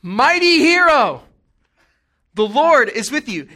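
loud, slow, high proclamation; an angel hailing a hiding man, grandly loud; booming angel. Retold or imitated. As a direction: announce it like a herald from heaven. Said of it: "he's like".

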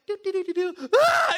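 high babble rising to a loud scream; mock-panicked women fleeing the goose; panicked shriek. Preacher imitating the women fleeing the goose, shrieking. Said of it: "they're just like".

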